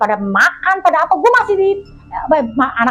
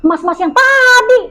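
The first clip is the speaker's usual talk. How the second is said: surprised recognition; 10 semitones higher, slower than usual. Retold or imitated. Recognising the ranger.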